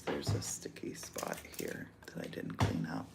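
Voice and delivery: hushed whisper, half to herself; whispered aside. Real, not acted. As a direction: drop to a whisper for a quick aside. Aside about a sticky spot.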